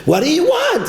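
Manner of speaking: high-pitched imitated protest; exasperated institution official. Official excusing the seventy percent, high-pitched mock exasperation. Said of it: "with an institution".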